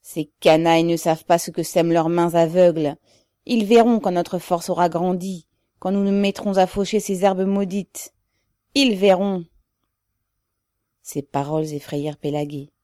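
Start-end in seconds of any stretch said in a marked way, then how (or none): 0.0-9.5 loud, threatening vow; menacing cry. A narrator's reading. Rebel vows revenge on the oppressors, loud and menacing. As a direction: declaim it as a threat, with force. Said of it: "cria d'une voix sourde avec un geste menaçant".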